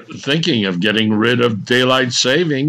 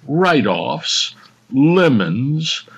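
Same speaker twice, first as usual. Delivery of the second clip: normal talking voice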